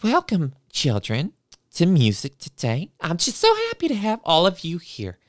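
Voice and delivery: high, syrupy sing-song teacher; sugary teacher voice. Retold or imitated. Teacher welcoming the kids to music class, high and syrupy. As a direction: gush over the children, sweetly high.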